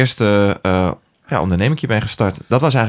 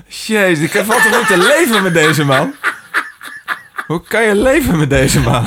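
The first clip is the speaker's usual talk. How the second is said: high, laughing disbelief; cracking up in disbelief; laughing exasperated; 11 semitones higher than usual. Real, not acted. Exasperated laughing outburst at Gordon. (lachend en ongelovig)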